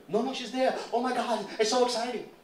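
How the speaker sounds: high, loud gushing; high loud thrilled neighbor; excited neighbor. Retold or imitated. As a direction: rave about the daughter on camera. Neighbor thrilled about daughter filmed.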